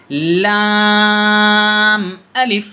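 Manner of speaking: slow, high chanted lam, then a quick alif; drawn-out recitation. Shown as a demonstration. Teacher shows pupils how to recite lam alif, high and drawn out. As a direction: stretch the lam long, clip the alif. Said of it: "अक्सर लोग हैं इसको अलिफ पढ़ते".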